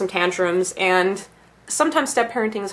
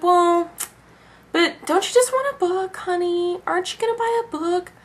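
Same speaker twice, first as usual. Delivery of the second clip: high, softened mom voice; coaxing mother; 9 semitones higher than usual. Retold or imitated. Mother ignoring the tantrum, offering her kid a book, coaxing. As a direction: gently wheedle in a high mock-mother voice. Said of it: "She's like".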